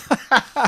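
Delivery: a loud, high burst of laughing; burst of laughter. Real, not acted. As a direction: let out a big loud laugh. Laughs about parents calling.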